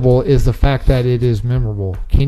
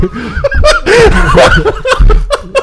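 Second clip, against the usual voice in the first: real burst of high laughter; excited high laugh; 5 dB louder than usual. Real, not acted. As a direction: laugh out loud, carried away. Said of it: "I got excited".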